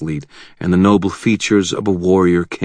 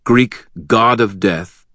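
ordinary delivery

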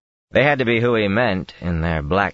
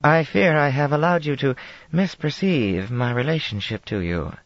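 lightly voiced Tarabana accent, higher; a hint of Tarabana accent; 9 semitones higher than usual. A narrator's reading. Aes Sedai refusing the queen, light Tarabana accent. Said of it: "in a Tarabana accent".

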